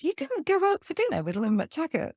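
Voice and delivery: high, incredulous question, pitched well up; astounded disbelief. Retold or imitated. Friends stunned she turned down dinner, high disbelieving question. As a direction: ask it as if you can't believe she skipped it. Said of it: "People have been astounded".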